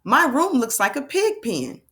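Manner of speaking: fluent and expressive model read-aloud. Shown as a demonstration. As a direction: read it in one flowing, lively sweep. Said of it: "we like to read with fluency".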